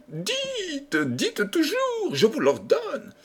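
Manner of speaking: very high, drawn-out royal command; impatient king. A narrator's reading. The king commanding Renardino to speak on, impatient. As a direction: order it in a squeaky high voice.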